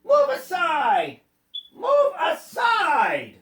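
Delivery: high, drawn-out shout; crying out. A narrator's reading. Tiny land crabs ordering the cat away, crying out. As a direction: call it out slowly, like a command.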